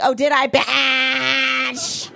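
slow, drawn-out mock-tearful snap; sassy comeback. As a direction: drag it out to pick a fight. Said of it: "And I was like".

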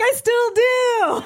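high, drawn-out whine; mock fearful wail. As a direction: wail it in exaggerated terror. Acts his frightened reply.

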